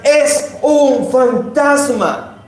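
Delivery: acted alarm, high and stretched out; mock frightened cry. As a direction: cry out slowly as if spotting a ghost. Disciples' terrified cry, acted.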